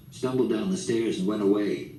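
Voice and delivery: ordinary delivery, nothing marked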